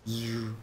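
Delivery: soft imitated buzz; quiet "zzz" like a magic alarm; buzzing ward sound. Game master voices a triggered ward, softly buzzing. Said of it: "The ward goes".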